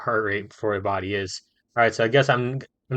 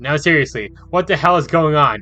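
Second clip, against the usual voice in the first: high, loud frantic questioning; scared and demanding; 6 semitones higher, 8 dB louder than usual. Acted. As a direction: demand answers in a panic. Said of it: "He sounds even more scared than I feel".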